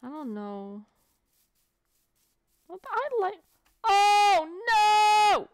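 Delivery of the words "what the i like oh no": high, loud panicked no; alarmed cry. Real, not acted. Gamer caught by a mob, high loud alarmed cry. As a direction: shriek out in alarm. Sudden danger in game.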